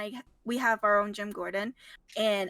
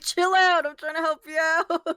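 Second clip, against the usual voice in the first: raised, higher voice, imitating exasperation; mock exasperated yell; 5 semitones higher, 5 dB louder than usual. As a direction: yell it like a retold plea. Acting out Gordon pleading with Batman.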